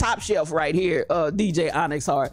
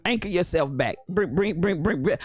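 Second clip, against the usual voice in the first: ordinary delivery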